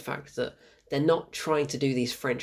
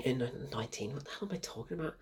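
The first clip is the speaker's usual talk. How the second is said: much softer, quick self-correcting aside; muttered aside; 12 dB quieter, faster than usual. Real, not acted. Reviewer fumbles the year and questions himself, muttered aside. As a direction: drop the voice right down, catching your own slip.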